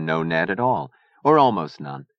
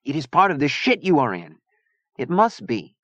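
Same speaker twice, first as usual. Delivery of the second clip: put-on high, accented character voice; fiery Zona, insistent; 7 semitones higher than usual. A narrator's reading. Zona pressing Chia about the trouble, insistent. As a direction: insist on it firmly.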